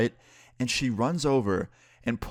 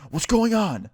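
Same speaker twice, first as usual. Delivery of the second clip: high, raised-voice imitation of the employee; retold alarmed call; 12 semitones higher, 5 dB louder, slower than usual. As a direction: call it out high and loud, like someone breaking up a fight. Employee running over to break up fight.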